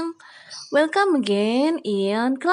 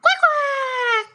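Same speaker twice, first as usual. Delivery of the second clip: duck quack. Pretend. Teacher imitating duck.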